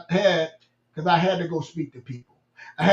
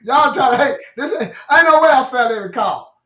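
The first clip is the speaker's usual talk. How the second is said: louder, heated protest about being picked on; raised-voice protest to the call checker; indignant retold protest; 5 semitones higher, 7 dB louder than usual. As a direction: insist it's unfair, voice raised. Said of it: "I said".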